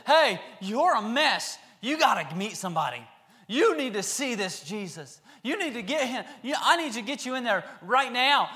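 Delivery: loud, laughing urgency; eager urging. Acted. Healed men urging the possessed man, loud and laughing. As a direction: push him to come meet Jesus.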